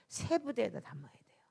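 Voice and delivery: hushed, whispered point to the congregation; whispered emphasis. Real, not acted. Preacher whispering a key point to the congregation. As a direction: lean in and whisper it.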